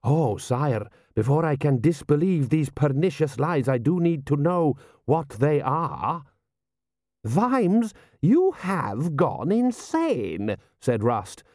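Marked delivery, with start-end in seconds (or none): 0.0-6.2 higher, measured general's voice; formal courtier's protest; smooth-talking general. Acted. General answering his prince. 7.2-10.6 high, slow, drawn-out outrage; aghast aristocrat, stretching each word high; incredulous lordly rebuke; appalled lord. Acted. Lord Rust appalled at Vimes.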